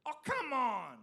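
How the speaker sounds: put-on high, loud, slow childish protest; whining kid. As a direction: stretch it out like a sulky little boy. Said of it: "That's his thing".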